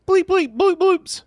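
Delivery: high-pitched beeping noises; robot beeps. Retold or imitated. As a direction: beep like a little robot. Imitating the girl's noises.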